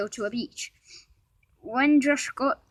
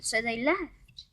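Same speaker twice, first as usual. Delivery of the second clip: ordinary delivery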